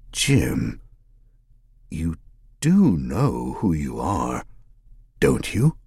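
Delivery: slow, understated concern; almost concerned. A narrator's reading. Killer questioning the detective, a touch of concern. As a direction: ask it as if gently worried for him. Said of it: "his tone almost concerned".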